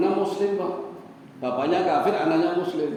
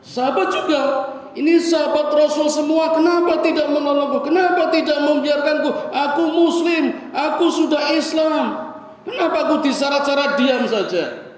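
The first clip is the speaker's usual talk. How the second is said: retold crying plea; 6 semitones higher than usual. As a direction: beg for help as the chained son, rising to a cry. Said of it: "nangis, jerit-jerit".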